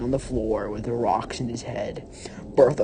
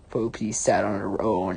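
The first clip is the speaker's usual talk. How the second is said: ordinary delivery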